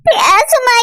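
crying plea. Acted. Daughter sobs calling her mother.

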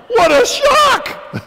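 high, loud, slow, laughing cry of shock; shocked exclamation. Real, not acted. Retelling the family discovery to the audience, laughing shock.

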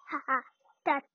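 ordinary talk

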